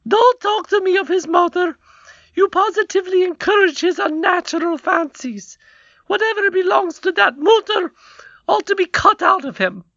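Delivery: high, loud scornful queen; angry queen voice. A narrator's reading. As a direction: snap at the king, very high. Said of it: "said his stepmother".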